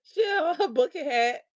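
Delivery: crying-laughing retelling; laughing while talking. Real, not acted. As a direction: push words through tears of laughter.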